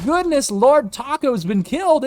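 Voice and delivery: 2 high, loud startled exclamation; 3 caught off guard by the kill, yelp it out; 1 surprised gasp. Real, not acted. Caster reacts to sudden kill.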